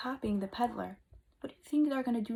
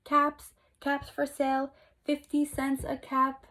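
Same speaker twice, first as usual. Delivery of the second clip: street vendor's call, a bit louder; 4 semitones higher, 5 dB louder than usual. Acted. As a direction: call your wares to the town. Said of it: "he walked back to town calling".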